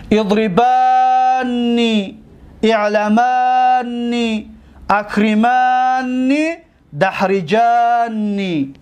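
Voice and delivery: very slow with long held vowels; chant-like drilling of verb forms, slowly; drawn-out paradigm recitation. Shown as a demonstration. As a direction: stretch the long aa in each form. Teacher reciting model verb forms.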